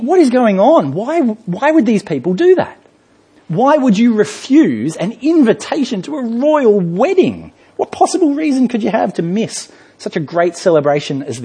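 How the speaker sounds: wondering aloud in disbelief; incredulous. Pretend. Preacher voicing a puzzled listener, high and incredulous. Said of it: "And you think".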